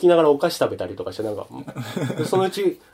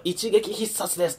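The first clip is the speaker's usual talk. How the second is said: slow, softer, a hint of a commentator; game announcer; 5 semitones higher, 6 dB quieter, slower than usual. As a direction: announce it like a broadcast, slowly. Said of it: "ずっと実況してくれんの". (実況アナウンサー風に控えめに)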